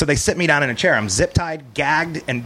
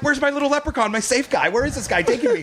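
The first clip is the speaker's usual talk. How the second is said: high, plaintive questioning; mock-helpless search for a protector; retold bewildered panic; 12 semitones higher than usual. Captive whose safe guy is gone, comically panicked.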